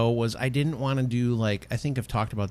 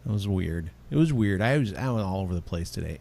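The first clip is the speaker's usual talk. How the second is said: ordinary talk, nothing marked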